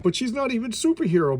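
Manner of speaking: high-pitched mock protest to Mom; high, put-on objection; objecting son. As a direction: imitate a son complaining, pitch jumping up. Voicing son objecting to his mother.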